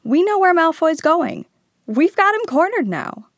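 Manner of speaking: loud, high, triumphant excitement; enthusiastic. A narrator's reading. Harry telling friends Malfoy is cornered, enthusiastic. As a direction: announce it like a breakthrough. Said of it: "said Harry enthusiastically".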